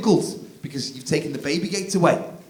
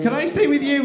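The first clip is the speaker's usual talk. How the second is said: put-on high kid plea; 12 semitones higher, 5 dB louder than usual. Child voiced.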